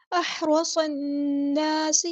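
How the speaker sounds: احرصن drawn out with a heavy, full ص; very slow chant of احرصن; tajweed recitation. Shown as a demonstration. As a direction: stretch احرصن very slowly. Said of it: "نون پہ غنہ زمانی ہو رہا ہے".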